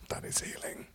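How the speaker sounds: hushed, voiceless whisper. Real, not acted. Preacher telling the room about healing, whispered. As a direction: let it drop to a soft whisper.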